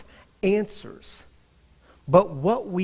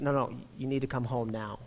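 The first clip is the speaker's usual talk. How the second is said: softer, low and firm; more serious; 6 semitones lower, 11 dB quieter than usual. Retold or imitated. Wife on the phone insisting her husband come home, quiet and serious. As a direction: say it quietly, no room for argument. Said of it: "She got a bit more serious".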